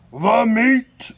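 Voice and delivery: loud high roar; crew roar. A narrator's reading. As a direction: roar for the meat. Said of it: "we all roared".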